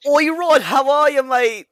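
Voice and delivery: mock British. Mock British greeting Ron.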